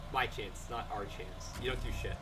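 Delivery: ordinary talk, nothing marked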